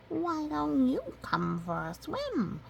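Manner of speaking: high, slow sweet duckling voice; duckling invitation. Acted. Ducklings inviting the chicks, high and slow. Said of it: "they ask the little chicks".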